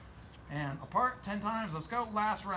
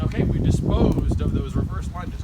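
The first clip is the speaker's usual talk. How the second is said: loud coach call; 9 dB louder than usual. Real, not acted. Coach shouting between sets.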